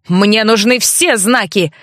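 usual speaking voice

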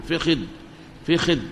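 فخذ as "fikhidh"; short i after both f and kh in فخذ; kasra on both letters. Shown as a demonstration. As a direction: say فخذ as fi-khidh. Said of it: "تكسر الحرف الأول مع متابعة للثاني".

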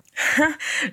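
a frank, open laugh from the chest; hearty laugh. A narrator's reading. Lee laughing at Hermione's predictable question, openly. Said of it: "Lee la regarda en riant franchement".

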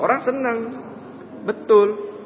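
usual speaking voice

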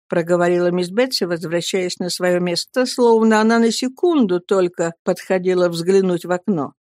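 ordinary delivery, nothing marked

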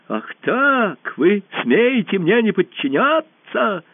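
high, indignant cry; outraged challenge. A narrator's reading. Usurper defied by the hall, outraged. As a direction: demand it high and drawn out, outraged.

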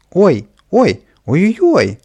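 high loud yelps of distress; wailing yelps. Acted. Kapriska hit by the hose, high wailing yelps. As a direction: yelp as the water hits. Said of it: "завопил наверху Каприска".

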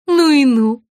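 marvel, unable to get over it; marvelling. A narrator's reading. Margarita can't get over the makeover, marvelling. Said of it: "никак не могла успокоиться".

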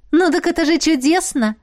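high, lively delight; perking up. Acted. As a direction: brighten up at the news. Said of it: "оживилась Фейри". (оживлённо, с радостью)

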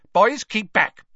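loud high barked command. A narrator's reading. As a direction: bark the order sharply. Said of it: "barked Professor Grubbly Plank".

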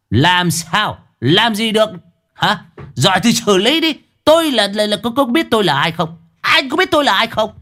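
cao giọng, lớn tiếng, thách thức; vênh mặt thách thức viên công an; arrogant taunting. A narrator's reading. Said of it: "tay chống nạnh vênh mặt". (giọng cao ngạo thách thức, lớn tiếng)

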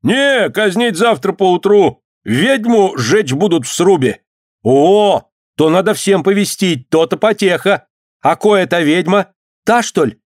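high-pitched, excited calling; acted onlookers shouting answers; crowd voices. A narrator's reading. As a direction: spread the news across the square. Crowd calls back and forth.

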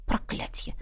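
very soft, voiceless swearing; muttered curse. A narrator's reading. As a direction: curse under your breath. Narrator curses to herself. (шёпотом ругаясь про себя)

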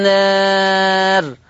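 النار with the alif tilted slightly toward "e", held very long; drawn-out النار, vowel between "aa" and "ee"; Warsh taqleel. Shown as a demonstration. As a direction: chant النار slowly with a half-tilted "aa". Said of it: "هكذا".

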